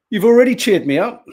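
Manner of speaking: booming cheerfully; caffeine-fuelled loud chat. Real, not acted. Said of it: "I don't know why I'm shouting".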